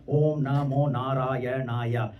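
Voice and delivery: syllables stretched to half speed; slow mantra recitation. Shown as a demonstration. Speaker showing the eight syllable mantra, slow and measured.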